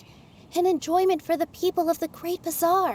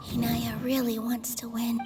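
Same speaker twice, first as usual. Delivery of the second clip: soft whisper, just to yourself; whispered aside; 5 semitones lower, 5 dB quieter than usual. Acted. Thinking about Inaya, a hushed whisper to herself. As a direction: whisper it so nobody hears.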